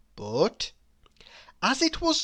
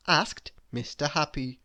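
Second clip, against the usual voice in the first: ordinary delivery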